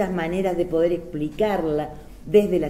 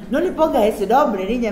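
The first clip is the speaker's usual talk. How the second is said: imitated great-grandmother's warning, higher and louder; scolding great-grandmother; 5 semitones higher, 6 dB louder than usual. Great-grandmother warning her about naming her son, imitated loud scolding. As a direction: raise your voice as an admonishing great-grandmother. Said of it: "me decía".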